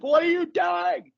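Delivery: very high, drawn-out puppet wail; Grover. Acted. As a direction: stretch it into a panicky cartoon squeal. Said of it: "I would do the Grover voice".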